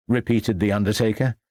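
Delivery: ordinary delivery, nothing marked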